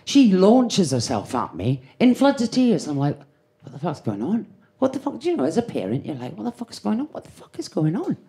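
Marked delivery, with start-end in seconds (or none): 3.6-8.2 soft, urgent what the fuck is going on; hushed panic. Retold or imitated. Mother sees her sobbing daughter, hushed alarmed panic. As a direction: whisper it to yourself in alarm. Worried parent inside head.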